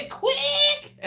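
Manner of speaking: shrieked high through laughter; high-pitched laughing squeal. Retold or imitated. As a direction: squeal the word while laughing. Imitating [name]'s cry.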